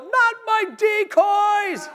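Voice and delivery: high, loud, slow cry of alarm; retold shout from the stairs; dad's panicked shout. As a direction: yell it like a dad seeing his decoys shot. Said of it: "my dad's running down the stairs going".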